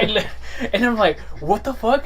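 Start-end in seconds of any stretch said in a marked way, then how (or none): 1.4-2.0 high, loud mock disbelief; surprised exclaim. Retelling the scam call, shocked exclaim. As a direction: blurt it out in shock.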